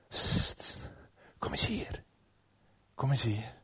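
psst, softly beckon her over; whispered serpent's lure; hushed luring. Acted. Slang lokt de vrouw. (zacht fluisterend lokken)

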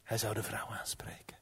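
very soft, secretive storytelling; whispered narration. A narrator's reading. Herneemt het verhaal fluisterend. (fluisterend verteld)

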